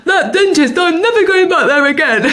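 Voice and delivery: laughing through a pretend numb mouth; numb-mouthed slur. Telling her mum after the dentist, speaking through anaesthetic. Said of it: "'cause I couldn't speak properly because of the anaesthetic".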